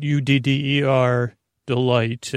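U D D E R spelled letter by letter, slowly. Shown as a demonstration. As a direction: spell out U D D E R, then say Delight. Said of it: "Utter Delight".

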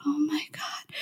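breathless whispered shock, high. Retold or imitated. Stunned at the stylist's remark, a whisper. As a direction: gasp it in a whisper. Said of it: "I was like".

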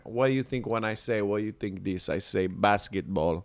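low, soft voice for the cab driver; quick, quiet cabbie patter; retold cab driver. Retold first cab driver's questions.

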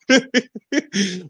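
short high laugh at the memory; real laugh. Real, not acted. Telling the ice-bath story to a partner, laughing. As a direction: laugh at his own trick on the friend.

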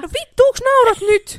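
high, loud fake indignation; hammed-up indignant shout; mock outrage. Real, not acted. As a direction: pretend to be offended.

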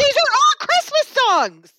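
high, loud, drawn-out protest; exasperated high protest. Real, not acted. Friend objecting to the Christmas song claim, high and loud. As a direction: pitch it way up in disbelief.

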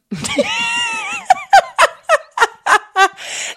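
very high, loud squealing laugh; real shrieking laughter. Real, not acted. Friends lose it at the mum question, real shrieking laughter. Shocked laughter at 'are you a mum'.

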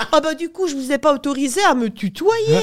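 mock French accent, offended; prim French accent. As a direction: imitate a stiff offended Frenchwoman. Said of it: "la Française répond".

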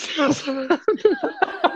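a sudden high laugh at his own story; high burst of laughter. Real, not acted. Laughing at own red card story.

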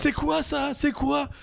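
high, lightly voiced old grouch; mock yourself as a baffled old fool; grumpy old fool. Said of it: "J'étais un vieux con". (vieux con légèrement imité)

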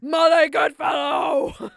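huge, high, drawn-out mock scream; screaming as dad. Imagining dad's text to the hosts, screamed imitation. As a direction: bellow the name slowly like an outraged dad. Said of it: "Screaming it at us".

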